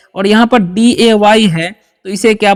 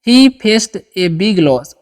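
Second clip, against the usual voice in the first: He faced a big loss said very slowly, word by word; slow model sentence; much slower than usual. Shown as a demonstration. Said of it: "sentence कैसे बना सकते हैं". (धीमे, साफ़ उच्चारण में वाक्य)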